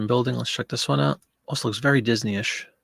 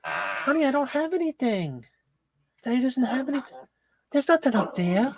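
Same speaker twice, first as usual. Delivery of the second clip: much higher sing-song voice for the dog; 11 semitones higher, 3 dB quieter than usual. Real, not acted. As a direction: coo up high to the begging dog. Talking to dog, high voice.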